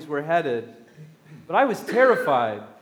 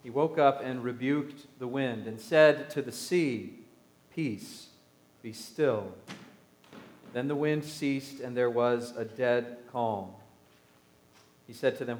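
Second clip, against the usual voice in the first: calm, measured reading of the Gospel; scripture reading; 3 semitones lower, 3 dB quieter than usual. Acted. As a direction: read it to the congregation, unhurried. Reads the Gospel aloud.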